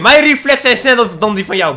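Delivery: ordinary talk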